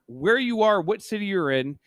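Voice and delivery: ordinary delivery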